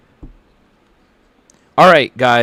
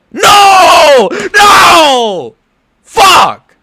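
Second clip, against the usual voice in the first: real anguished scream, much higher and louder; furious shout; 9 dB louder, much slower than usual. Real, not acted. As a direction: scream at the loss. His fighter gets submitted.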